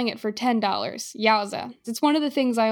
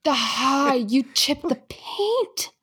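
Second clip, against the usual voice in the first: whiny accusation; 4 semitones higher, much slower than usual. Retold or imitated. Mimics accusing buyer.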